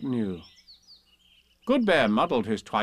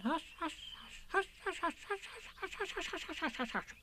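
much higher, much softer rapid whispered hushes; hasty hushes; 13 dB quieter than usual. Acted. Friends passing hush along, hasty whispers. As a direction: hiss hushes down the line. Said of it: "said hastily to each other".

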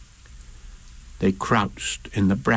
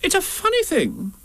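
very high musing; Rabbit. A narrator's reading. Rabbit lost in the mist, high musing voice. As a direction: muse in a high put-on voice. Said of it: "said Rabbit ten minutes later".

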